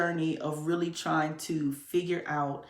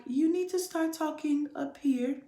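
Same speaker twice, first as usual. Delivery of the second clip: imitating the teacher, pitched much higher; teacher's high voice; 7 semitones higher than usual. Voice teacher urging her to speak higher, high imitation. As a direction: quote the voice teacher up in a high soprano register. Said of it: "So she was like".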